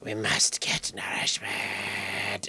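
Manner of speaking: slow, rasping zombie whisper; thirsty zombie liquid. Pretend. Liquid begging from the mouth, slow zombie whisper. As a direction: plead like a parched undead thing. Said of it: "the liquid in my mouth is going".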